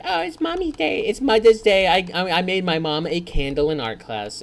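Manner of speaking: put-on high, excited little kid. Child telling mom about Mother's Day, high and eager. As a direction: chirp it like a proud small child. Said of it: "as a kid, it's like".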